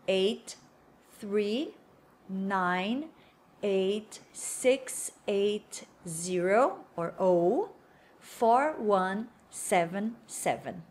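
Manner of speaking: one number at a time, slow, with pauses; digit by digit. Shown as a demonstration. Teacher showing learners how to give a phone number, slow digit by digit. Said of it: "falar número por número".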